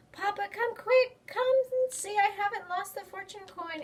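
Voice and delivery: high, loud joyful call; excited calling. Acted. Child calling papa after finding her coin, excited. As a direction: shout for papa in delight.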